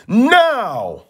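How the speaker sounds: high barked command; sharp signal. Acted. Stepmother signals the others to push, high sharp command. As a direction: snap the signal to strike.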